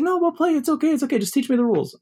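very high, chirpy uncle voice; eager uncle. Retold or imitated. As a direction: pitch the uncle way up, keen to play.

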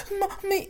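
a sharp, high, breathy gasp, then a small "Mommy" way up high; mimicked sobbing gasp.

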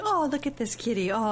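high-pitched fawning over a kitty; imitated cooing. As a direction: coo in mimicry at a cat. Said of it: "she's like".

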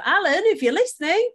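playful call-out. Real, not acted. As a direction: call out cheekily, high-pitched, breaking into a laugh. Playful appeal to an absent listener.

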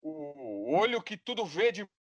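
dramatic announcer introduction; loud carnival barker. Acted. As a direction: build it like a ringmaster. Grand guest introduction.

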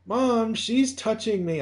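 put-on high, slow whining child; whiny kid. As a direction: whine it like a tattling kid. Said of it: "that stupid ad".

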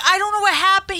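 high, teary, helpless; near tears. Retold or imitated. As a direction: squeak it out close to crying. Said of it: "on the verge of tears".

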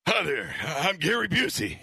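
slow celebrity impression; contest-entry impression, unhurried; Gary Busey. Shown as a demonstration. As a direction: introduce yourself in a drawn-out put-on voice. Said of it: "better impression of Gary Busey".

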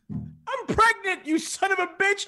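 very high, slow wail of an upset wife; hammed-up crying wife, drawing out the words; imitated tearful outburst. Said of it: "she starts crying".

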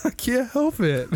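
giggling through the words; can barely speak for laughing. Real, not acted.

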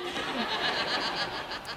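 a real laugh breaking through; laughing at the joyful moment. Real, not acted. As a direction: let the laugh spill out. Laughter after the girl's shout.

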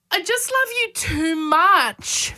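high, slow, laughing and almost crying; son's voice, tearful laugh. Retold or imitated. Mother quoting her son's bedtime words, laughing tearfully. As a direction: squeeze the words out overwhelmed with love. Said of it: "he'll say something".

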